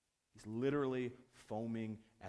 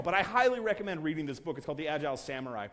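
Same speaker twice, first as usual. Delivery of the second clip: usual speaking voice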